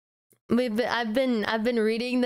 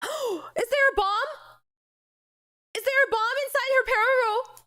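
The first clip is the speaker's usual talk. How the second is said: high, gasping alarm; high voiced panicked girl; startled gasp. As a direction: squeak it out in shock.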